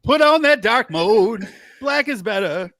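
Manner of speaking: high, slow laughing call. Real, not acted. Laughing, sung-out line.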